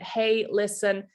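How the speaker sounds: usual speaking voice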